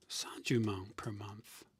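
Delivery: whispered aside about his profit. Real, not acted. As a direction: breathe it out like a secret. Whispers his own cut.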